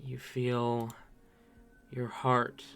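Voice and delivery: slow, hushed scene-setting narration; story narration. A narrator's reading. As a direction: draw them into the dusk slowly.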